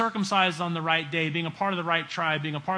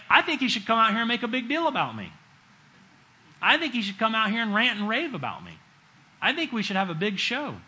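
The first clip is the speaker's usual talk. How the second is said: huffy retold complaint, a bit higher and quick; mock indignation; 5 semitones higher than usual. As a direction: play the offended general wanting a show. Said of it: "he gets angry".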